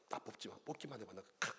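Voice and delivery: quiet threat in a joking tone; muttered mock threat. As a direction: mutter the threat under your breath. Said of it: "이렇게 하지 마시고".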